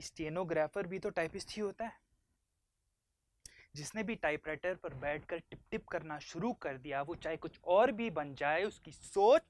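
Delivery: loud scornful tirade, building; impassioned, contemptuous outburst; heated argument. Acted. Son argues heatedly against being typist.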